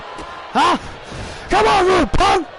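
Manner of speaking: high shouted heel taunt; angry wrestler taunt. Acted. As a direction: yell it down at the beaten opponent. Kevin Owens voiced taunting.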